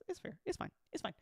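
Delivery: ordinary talk, nothing marked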